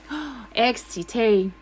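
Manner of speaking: plain reading voice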